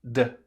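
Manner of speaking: "D" as one short voiced tap. Shown as a demonstration. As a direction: sound "D" with the voice on. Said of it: "ele é vozeado?". ("D" vozeado)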